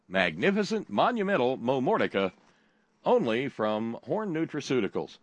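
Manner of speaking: booming ad tagline; announcer tagline. Pretend. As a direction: punch the brand name like a jingle.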